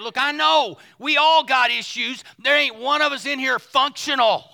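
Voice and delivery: loud, high-energy pulpit voice; preaching. Real, not acted. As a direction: punch it out to the whole congregation. Preaches at full force.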